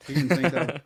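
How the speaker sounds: laughing. Real, not acted. Laughing at his own punchline.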